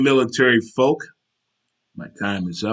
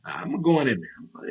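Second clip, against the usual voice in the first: drawn-out, higher-pitched resolve; slow and sure of going in; game to go in; 5 semitones higher, 4 dB quieter, slower than usual. Acted. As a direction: stretch it out, eager to walk in. Contrasts it with his bold attitude.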